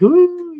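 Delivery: high mocking crowd ooh; a teasing wedding-crowd ooh. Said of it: "they were like".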